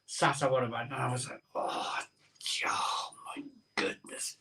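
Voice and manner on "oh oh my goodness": whispered, slow and pained; hungover whisper. Retold or imitated. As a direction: breathe it out like your head is splitting.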